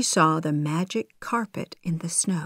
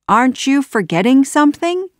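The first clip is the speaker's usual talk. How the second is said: quick, high, loud taunt; pointed question; 8 semitones higher, 6 dB louder, faster than usual. A narrator's reading. Aladdin holds up the lamp, taunting Jafar brightly. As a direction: tease him with the question.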